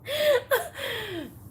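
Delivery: high-pitched burst of laughter; amused laugh. Real, not acted. Collector laughing at her own retold reply, high laugh.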